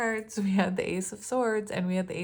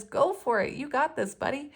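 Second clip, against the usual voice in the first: high encouraging cheer; upbeat mock pep talk; cheering intuition; 8 semitones higher than usual. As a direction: cheer them on like a buddy. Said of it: "their intuition says".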